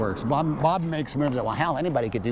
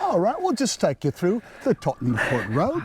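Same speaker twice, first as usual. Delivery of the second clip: high mock British London cabbie accent; 5 semitones higher than usual. Joking about stealing British actors' jobs, mock British cabbie accent. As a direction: play a chirpy British cab driver, pitched up. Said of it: "me and John Hamm going over and say".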